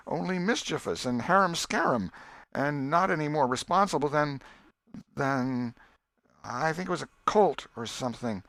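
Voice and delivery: put-on a boy spinning a tale, feigning recall; boy Tom voiced. Tom recounts dream.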